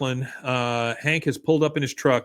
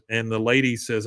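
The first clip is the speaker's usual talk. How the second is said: usual speaking voice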